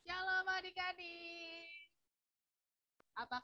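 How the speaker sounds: faint, distant voice on a call; speaking far from the mic; phone held away. Real, not acted. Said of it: "suaranya kecil banget nih".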